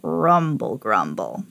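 low, rolling rumble; rumbling noise. A narrator's reading. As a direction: roll the words out low like a growling sound. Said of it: "he heard the grumble".

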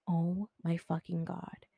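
slow, hushed disbelief; retold whisper of dread; spooked whisper. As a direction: whisper it slowly, as if creeped out. Spooked reaction to finding nobody there.

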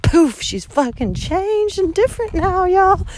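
high, slow mocking voice of people; mock the people who think they know her; mocking others. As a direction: imitate judgmental people, sing-song. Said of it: "and then they're like".

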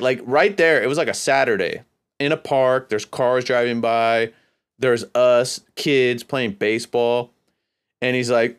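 ordinary delivery

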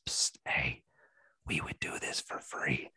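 conspiratorial whisper to the audience; breathy secret-telling whisper; stage whisper. Pretend. Secret aside to listeners.

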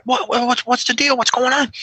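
high, hurried questions; sergeant demanding answers. Retold or imitated. Sergeant at the door asking.

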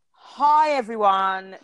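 normal talking voice

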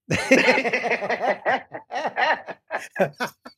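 high burst of real laughter; big laugh. Real, not acted. Guest cracking up retelling mentor's reply, real laughter.

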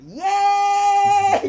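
high, loud stretched-out whoop; excited cheer. Retold or imitated. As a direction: whoop like an idol taking the stage. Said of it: "って言いながら".

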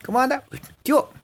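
high-pitched acted hello; lively mock greeting to a buddy; casual street greeting. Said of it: "Así como cuando uno dice".

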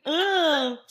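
high, loud disgusted groan; grossed out. Real, not acted. Friend disgusted by her own hair, loud and high. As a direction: say it like it's revolting.

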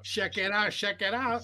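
a hammed-up hype catchphrase; Angel's catchphrase. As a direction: mimic the club guy's catchphrase.

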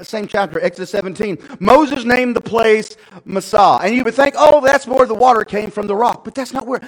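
loud, high exhortation easing slightly; full-force preaching. Real, not acted. Preacher telling congregation we've all been there, full-force.

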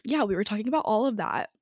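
ordinary delivery

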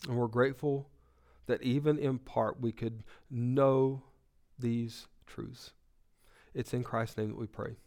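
hushed, reverent prayer voice. Acted. Pastor praying over the congregation, hushed and reverent. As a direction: pray it softly, bowed and humble.

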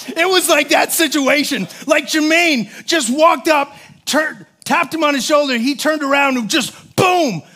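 breathless excitement as the bully drops; excited retelling. Real, not acted. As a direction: build it up like the big moment of the fight. Excited climax of fight story.

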